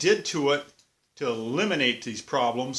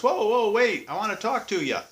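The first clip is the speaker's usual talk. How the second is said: mock trooper calling out, higher and louder; retold holler; 7 semitones higher, 3 dB louder than usual. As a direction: call him back like a cop. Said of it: "he hollers".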